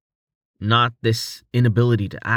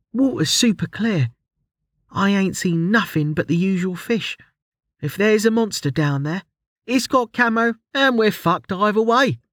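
higher-pitched Ruli voice, bright and breezy; cheerful; 9 semitones higher than usual. A narrator's reading. Ruli peering into the water, cheerfully unbothered. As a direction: toss off the danger lightly, cheerful. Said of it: "she said cheerfully".

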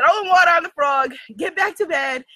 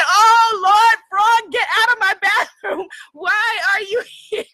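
louder, higher panic at the frog; panicked yell; 5 semitones higher, 5 dB louder than usual. Retold or imitated. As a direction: shoo the frog out in a laughing panic. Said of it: "I was thinking".

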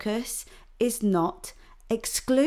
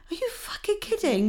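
higher, incredulous outraged cry; mild outrage; 11 semitones higher than usual. Real, not acted. Host outraged at the reasons for the TV rethink, incredulous. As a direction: disbelief boiling over. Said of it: "God, I was shouting".

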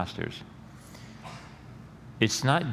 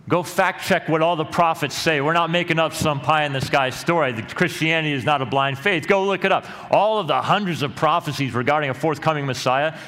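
loud, high, fervent preaching that drives each point home; full-force preaching; 7 semitones higher, 5 dB louder than usual. Real, not acted. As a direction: thunder it from the pulpit, daring them to check.

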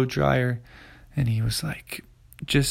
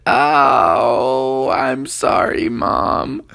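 teary apology; 7 dB louder, much slower than usual. A narrator's reading. Kid apologizing.

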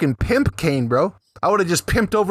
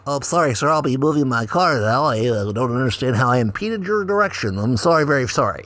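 lower, timid mock apology from the driver; mock groveling apology; 5 semitones lower than usual. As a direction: grovel like a scared driver, half-joking. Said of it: "then he's like".

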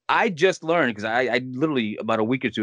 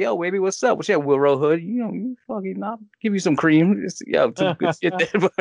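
flirty come-on voice; 7 semitones higher than usual. Retold or imitated. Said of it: "I'll go on websites".

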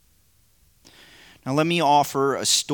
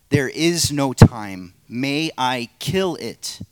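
slow and louder, every word weighed; the angel, insistent; 3 semitones higher, 8 dB louder, slower than usual. Acted. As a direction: press slowly and firmly. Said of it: "play the narrator and three parts".

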